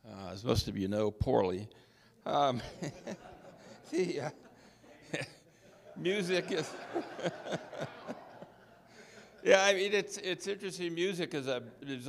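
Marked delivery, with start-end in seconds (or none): 2.7-3.9 a quiet breathy chuckle at himself; sheepish laugh. Real, not acted. Laughs at own poor singing. 4.3-5.4 a short breathy chuckle; sheepish laugh. Real, not acted. Laughs at own poor singing. 6.7-9.4 a long breathy laugh, fading away; sheepish laugh. Real, not acted. Laughs at own poor singing.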